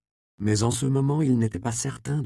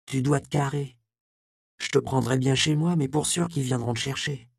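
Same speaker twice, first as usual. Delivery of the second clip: urging to flee; 6 semitones higher than usual. Acted. Magdala urges him to flee.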